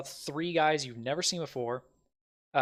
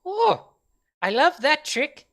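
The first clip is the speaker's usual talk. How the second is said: high, slow, delighted little voice; impressed goblin; 8 dB louder, much slower than usual. Acted. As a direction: coo it in a squeaky voice like a small creature. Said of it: "goes like".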